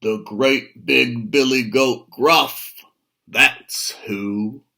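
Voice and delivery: loud, bold goat roar; roaring big goat. Acted. Big goat roars back at the troll. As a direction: fire it right back at him. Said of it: "roared the third billy goat".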